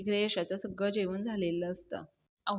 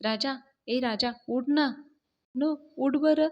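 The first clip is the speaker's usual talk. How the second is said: raised, higher wake-up call; higher-pitched coaxing call; calling out to wake someone; 4 semitones higher, 5 dB louder than usual. Acted. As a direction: call out a sleeper's name to rouse him. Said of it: "हाक मारायला लागते".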